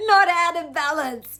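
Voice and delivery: laughing loudly through the words; high loud laugh spilling into the line. Real, not acted. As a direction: bursts out laughing mid-sentence. Laughs at her own phrase.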